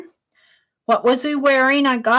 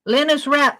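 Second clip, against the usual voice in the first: ordinary delivery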